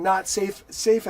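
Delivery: loud mock shouting of "Not safe"; mock yelling. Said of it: "all three of them are yelling".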